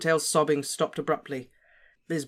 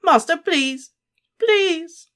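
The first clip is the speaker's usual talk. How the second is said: high, loud desperate plea; begging; 9 dB louder, much slower than usual. A narrator's reading. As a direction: wail the pleas, rising. Wormtail begs in pain.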